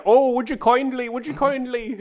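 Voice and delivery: high-pitched mock-Irish pleading; lilting, exaggerated Irish radio voice; Atlas. Co-host quoting Atlas saying it twice, high mock-Irish voice. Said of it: "He does say it like that".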